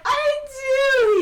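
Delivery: put-on high, loud childish protest; indignant shout. Player quoting her character, childish shout. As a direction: yell it like a stubborn sibling.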